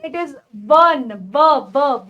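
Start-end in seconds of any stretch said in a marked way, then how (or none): 0.7-1.1 one said slowly, starting with a w sound. Shown as a demonstration. Teacher stresses the w sound in one for students. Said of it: "ब की sound आ रही है". 1.1-2.1 ब said alone, low and clipped twice; w sound. Shown as a demonstration. Teacher isolates the sound ब for students. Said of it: "ब की sound आ रही है".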